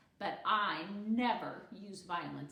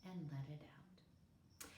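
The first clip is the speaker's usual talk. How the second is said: breathed out in a whisper; whispered exhale; 6 semitones lower, 13 dB quieter, slower than usual. A narrator's reading. Gooney Bird settling herself before the story, whispered. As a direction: let the words go on the breath. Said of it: "took one more deep breath".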